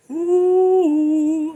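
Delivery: long, high sustained "ooh"; exasperated ooh. Real, not acted. Storyteller reacting to that jerk guy, exasperated ooh. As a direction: hold a high hoot of disbelief.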